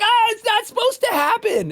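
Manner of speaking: high, loud mock wail of protest; comic dismay. Husband answering his upset wife, hammed-up dismay.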